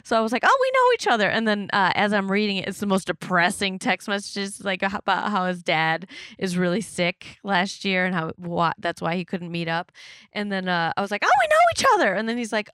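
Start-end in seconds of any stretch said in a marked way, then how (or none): 0.4-1.3 high, loud, over-eager blurt; gushing outburst. Retold or imitated. Retelling her text reply to a match, gushing. 11.2-12.1 high, loud, over-eager blurt; gushing outburst. Retold or imitated. Retelling her text reply to a match, gushing.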